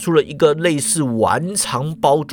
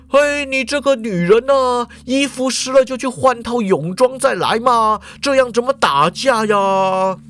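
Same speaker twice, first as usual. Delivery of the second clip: mock scolding; 7 semitones higher than usual. A narrator's reading. 见鬼愁 scolding invisible ghost.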